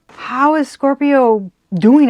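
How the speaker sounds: loud, high, drawn-out question; acted bafflement. Reader voicing rivals wondering about Scorpio, loudly baffled.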